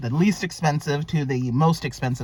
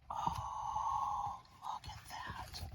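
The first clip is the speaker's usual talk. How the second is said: very slow, drawn-out whisper; awed whisper; 19 dB quieter, much slower than usual. Real, not acted. Vlogger showing a page she adores, awed whisper. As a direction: breathe it out slowly in wonder, whispered.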